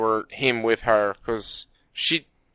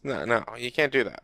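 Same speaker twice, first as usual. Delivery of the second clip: ordinary delivery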